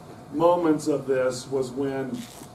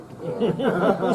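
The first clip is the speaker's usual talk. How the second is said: burst of laughter; 4 semitones higher than usual. Real, not acted. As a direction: laugh out freely. Laughing at needle story.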